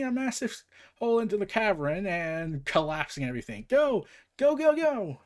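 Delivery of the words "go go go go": high loud hurried go go; urgent shouting. Acted. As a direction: yell them forward. Escaping collapsing cavern.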